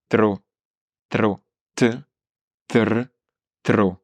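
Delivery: "tro" with a trilled r after t; rolled r. Shown as a demonstration. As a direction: roll the r in "tr" and "tro". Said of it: "remonter la pointe de la langue en soufflant".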